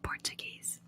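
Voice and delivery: ordinary delivery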